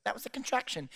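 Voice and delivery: dainty mock labor. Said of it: "It's like guttural".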